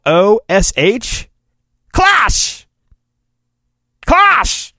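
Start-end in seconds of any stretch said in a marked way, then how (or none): 1.9-2.7 high-pitched comic clash yell; high sound effect cry. Shown as a demonstration. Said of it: "I'm gonna pronounce it Klosh". 4.0-4.7 high-pitched comic clash yell; high sound effect cry. Shown as a demonstration. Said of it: "That's the sound effect".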